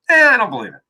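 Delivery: scoffing "Eh" with a lazy shrug; dismissive brain voice. Retold or imitated. The brain scoffs at an affirmation, dismissive. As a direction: brush it off like a doubting brain. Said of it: "your brain just says".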